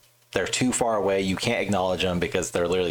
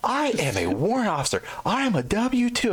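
high, puffed-up mock officer voice; mock his self-importance, pitched up; pompous officer; 14 semitones higher than usual. Mocking the warrant officer announcing rank, high pompous voice. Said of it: "He's like".

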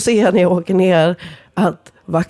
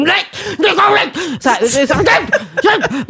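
flustered scolding; 8 semitones higher than usual. Retold or imitated. Yelling at dog eating napkin.

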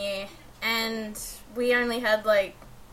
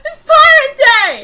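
high, loud, slow exclamation; excited cheer; 13 semitones higher, 14 dB louder, slower than usual. Acted. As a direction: announce it like a party.

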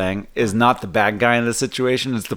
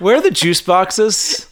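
put-on whiny kid; 9 semitones higher, 5 dB louder, slower than usual. Mocking a sheltered kid.

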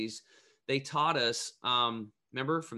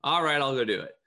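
loud resigned agreement; 6 dB louder than usual. Retold or imitated. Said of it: "I'm like".

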